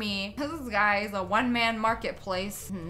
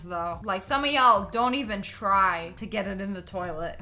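ordinary talk, nothing marked

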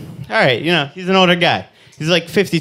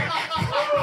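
high laugh; 9 dB quieter than usual. Real, not acted. Laughing at own joke.

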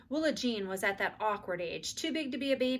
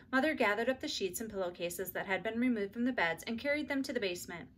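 ordinary delivery, nothing marked

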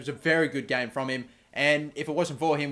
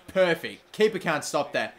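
ordinary talk, nothing marked

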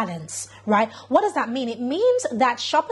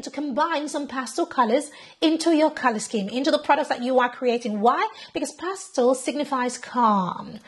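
ordinary delivery

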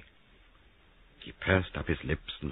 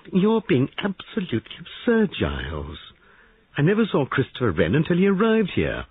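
very high, loud protest; indignant denial; 11 semitones higher, 5 dB louder than usual. Acted. Molly denying she knew Christopher, indignant. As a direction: deny it hotly. Molly denies Giles's accusation.

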